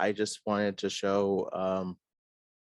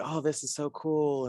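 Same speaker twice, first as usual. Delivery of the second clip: higher-pitched, retold enthusiasm; imitated delighted praise; 6 semitones higher than usual. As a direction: mimic someone impressed by the work. Retelling band members' delighted reaction.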